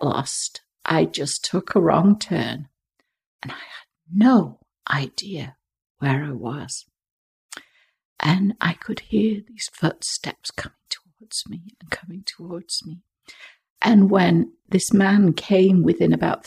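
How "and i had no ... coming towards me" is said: hushed, suspenseful storytelling whisper. Retold or imitated. Retelling footsteps in the fog.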